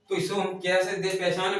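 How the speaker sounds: usual speaking voice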